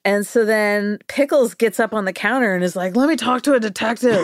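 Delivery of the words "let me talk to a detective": high, put-on voice making a demand; demanding dog. Dog on police counter demands a detective, high and insistent. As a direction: pitch it up like an insistent little character.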